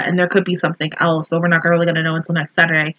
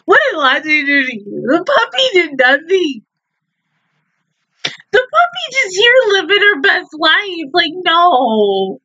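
high-pitched, wailing objection; upset protest; 8 semitones higher than usual. Real, not acted. Viewer defending the puppy after a painful episode, upset and high. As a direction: plead the puppy's innocence, voice pitched up.

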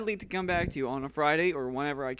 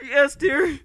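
high stammering yes dear, louder, laughing; mock cowed; 9 semitones higher, 9 dB louder, much slower than usual. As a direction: stammer like a browbeaten husband. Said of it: "Sounds like he's abusing you".

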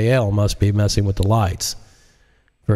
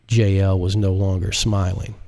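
ordinary delivery

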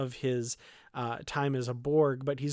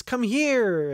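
loud, higher call; retold yell; 8 semitones higher, 6 dB louder than usual. Daughter summoning her father, loud high call. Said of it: "And she yells".

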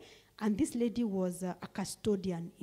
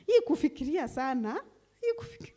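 mocking whine rising much higher, breaking into a cry; mock scolding; 5 semitones higher, 4 dB louder, faster than usual. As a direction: parody a nagging relative, voice climbing high. Mocking people scolding the sick.

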